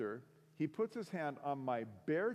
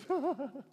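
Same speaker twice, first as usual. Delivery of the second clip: short high-pitched laugh; mocking laugh; 11 semitones higher, 3 dB louder than usual. Girlfriend hearing about church, mocking laugh. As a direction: imitate a derisive little laugh, pitched up.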